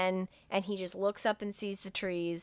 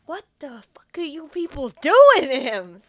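loud, rasping imitated fury; acted-out bellow of outrage; outraged yell; 6 semitones higher, 13 dB louder than usual. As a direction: shout accusingly at the whole staff. Same man yelling at staff.

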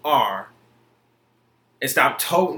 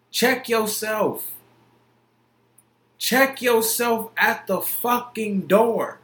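slow stern warning; 4 semitones higher, slower than usual. Real, not acted.

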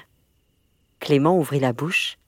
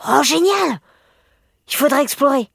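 put-on high, loud boyish excitement; eager, pitched-up enthusiasm; thrilled; 11 semitones higher, 6 dB louder than usual. A narrator's reading. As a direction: burst out delighted at the plan. Boy thrilled by secret plan.

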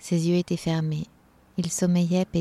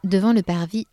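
ordinary delivery

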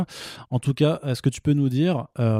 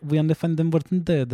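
higher-pitched English title in a thick Italian accent; bad Italian-accented English; 4 semitones higher than usual. Shown as a demonstration. Host mocking Italians' poor English, title in a bad Italian accent. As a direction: mangle the English like an Italian speaker. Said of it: "avec le mauvais accent anglais".

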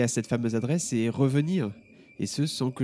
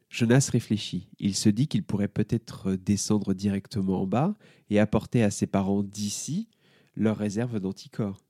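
plain reading voice

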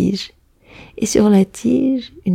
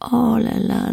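ordinary delivery, nothing marked

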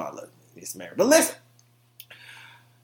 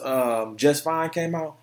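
usual speaking voice

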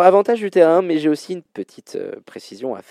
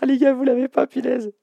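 high-pitched cry of disbelief; surprised dismay; 13 semitones higher than usual. Real, not acted. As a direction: squeal it out, amazed they missed it. Host reacts, nobody found answer.

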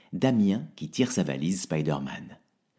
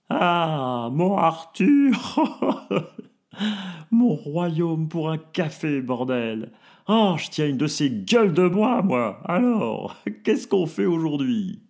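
brash, slurred party voice; boisterous hungover friend; hungover reveller; 9 semitones higher, 5 dB louder, slower than usual. Acted. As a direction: stagger out demanding coffee. Hungover Josiane stumbles out.

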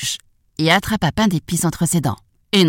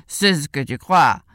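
lightly voiced horse; horse character. A narrator's reading. Horse retorts to the gingerbread man, lightly voiced horse. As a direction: answer back as a horse after a whinny. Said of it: "Le cheval sursauta et dit".

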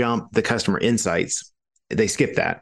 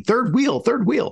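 squeaky, very high-pitched mock complaint; mock whine; 13 semitones higher, slower than usual. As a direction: squeal it like a comic protest. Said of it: "And you're like".

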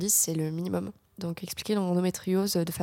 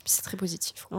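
whispered aside; 5 dB quieter than usual. Real, not acted. Quiet aside.

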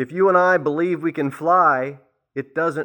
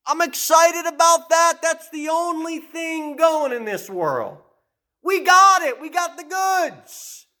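full-force preaching; 11 semitones higher, slower than usual. Acted. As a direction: preach it out, fired up and slow.

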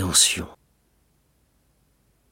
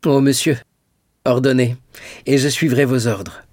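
raised, loud, earnest pledge; eager devotion; 3 semitones higher, 3 dB louder than usual. A narrator's reading. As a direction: offer yourself eagerly to obey. Dantès eagerly pledges obedience. (dévouement empressé)

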